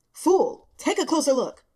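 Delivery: haughty cat scolding. A narrator's reading. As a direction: snap at the fool like a cranky cat.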